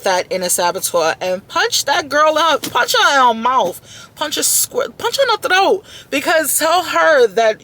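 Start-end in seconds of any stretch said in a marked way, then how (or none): 1.5-7.6 fired-up hype. Acted. Fired-up pep talk against saboteur.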